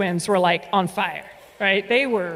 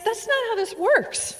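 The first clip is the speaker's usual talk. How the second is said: high insistent; 10 semitones higher than usual. Retold or imitated. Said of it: "And I said".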